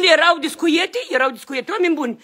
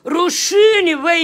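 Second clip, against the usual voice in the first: indignant shout; 3 semitones higher, much slower than usual. Real, not acted. Man to officers searching his home, indignant shout.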